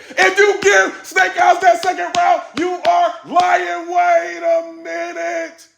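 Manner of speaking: very high yell, stretched out at the end; outraged shout. Pretend. Reviewer scolding fans who score round two wrongly, high shouting. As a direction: holler in hammed-up disbelief.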